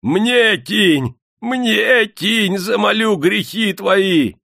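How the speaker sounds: очень высоко и громко, надрывно; вопит, протягивая руку; вопль. A narrator's reading. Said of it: "он вопил". (юродивый вопит, выпрашивая монету)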